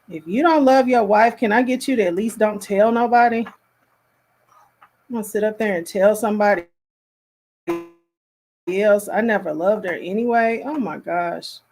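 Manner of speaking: ordinary talk, nothing marked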